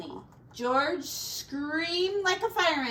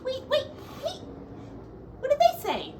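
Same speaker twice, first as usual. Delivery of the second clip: high, fast, baffled wait wait wait; baffled exclamation; 14 semitones higher, much faster than usual. Acted. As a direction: sound totally thrown by what you heard. Character baffled by oinking animals.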